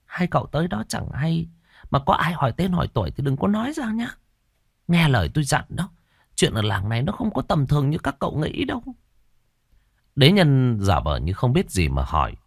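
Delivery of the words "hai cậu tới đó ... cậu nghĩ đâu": put-on higher, hushed older woman cautioning; landlady quietly warning two strangers, careful and hushed. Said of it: "Bà chủ cẩn thận nói".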